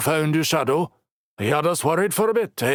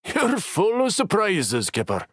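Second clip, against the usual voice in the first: chuckling; 13 semitones higher than usual. Acted. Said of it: "Logan chuckled".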